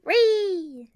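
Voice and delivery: high loud playful wee; gleeful squeal. Acted. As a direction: squeal like a kid on a slide.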